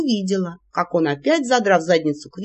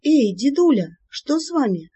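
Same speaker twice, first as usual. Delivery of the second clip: cautious; 6 semitones higher than usual. Acted. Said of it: "осторожно поинтересовалась".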